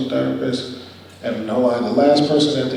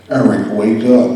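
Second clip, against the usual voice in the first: slow, much louder demonic call; 11 dB louder, slower than usual. Retold or imitated. Feverish patient hears his mom as a demon, demonic boom. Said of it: "It kinda sounded like a demon".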